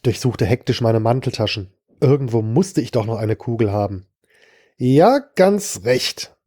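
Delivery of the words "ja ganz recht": put-on slow, slurred drunken words. A narrator's reading. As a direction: drunk hitman slurs a confirmation to his victim, slowly. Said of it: "Er bemerkte meinen Lallen".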